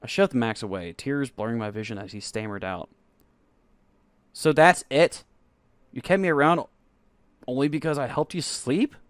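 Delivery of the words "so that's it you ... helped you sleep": loud, high, tearful accusation; hurt accusation. Acted. Narrator shoving boyfriend away, loud hurt accusation. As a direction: fling it at him through tears.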